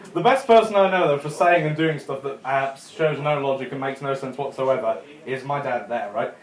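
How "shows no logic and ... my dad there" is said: ordinary talk, nothing marked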